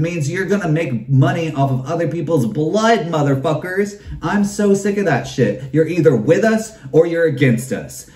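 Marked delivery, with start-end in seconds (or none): none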